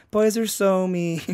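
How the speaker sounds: high, slow, drawn-out pout; mock whine. Comic voicing the girl left on stage, mock whine. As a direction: imitate a hurt, whiny complaint. Retold abandoned audience member whining.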